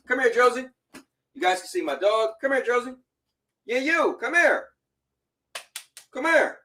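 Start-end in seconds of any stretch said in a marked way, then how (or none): 0.1-0.7 calling the dog. Real, not acted. Calling dog over to camera. 2.4-6.6 high, sing-song dog coaxing. Real, not acted. Owner coaxing his dog over, high sing-song voice.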